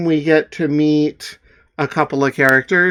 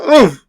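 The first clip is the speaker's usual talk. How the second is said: pained oof; 10 semitones higher, 8 dB louder than usual. Acted.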